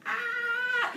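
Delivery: high startled squeal; high yelp. Retold or imitated. Kid seeing brother dragged out, squealing. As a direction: squeal in alarm.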